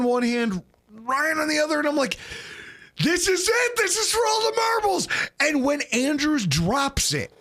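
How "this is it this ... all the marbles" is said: high-pitched, excited re-enactment; worked-up fan cry. Retold or imitated. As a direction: hype up your friends for the deciding play. Reenacting tense final moment in stands.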